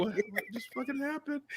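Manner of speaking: high, hushed bewildered voice; freeze, then squeak it out softly; stunned disbelief. Retold or imitated. Retelling his stunned reaction.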